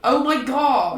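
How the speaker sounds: real burst of loud, amused disbelief; loud laughing outburst. Real, not acted. Player reacting to the game master, loud laughing exclamation. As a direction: exclaim loudly through a laugh.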